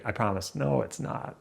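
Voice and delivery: ordinary talk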